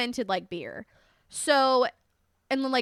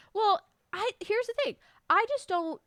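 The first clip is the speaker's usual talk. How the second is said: high disbelief; 8 semitones higher, 3 dB quieter than usual. Real, not acted. Disbelief at rule.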